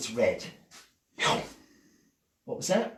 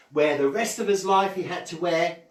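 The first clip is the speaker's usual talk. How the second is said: normal talking voice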